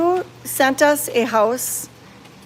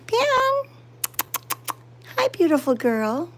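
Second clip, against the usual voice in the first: high, slow cooing at a cat; pet talk; 8 semitones higher than usual. Real, not acted. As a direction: coo up high to the kitty.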